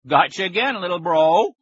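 loud, high mocking gloat; hee-hawing taunt. A narrator's reading. Big brother gloats over a sandwich prank, loud jeer. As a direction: crow it at full voice. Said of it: "Colin hee-hawed".

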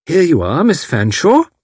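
cheery matron's welcome; bright. A narrator's reading. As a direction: matron brightly presents the visitor. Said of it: "she said brightly".

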